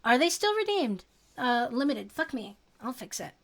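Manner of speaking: usual speaking voice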